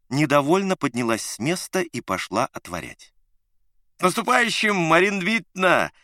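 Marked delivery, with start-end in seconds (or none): 4.0-5.9 loud, bright, high greeting; cheerful shout. A narrator's reading. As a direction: yell it out merrily. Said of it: "весело заорал".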